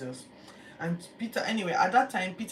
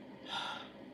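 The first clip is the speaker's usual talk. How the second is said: weary sigh; 11 dB quieter than usual. Retold or imitated. Peter asked third time.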